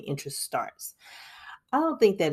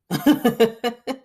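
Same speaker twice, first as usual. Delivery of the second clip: loud rising laugh; 8 semitones higher, 9 dB louder than usual. Real, not acted. Laughing at her own retold comeback, loud rising laugh. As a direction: break into a laugh.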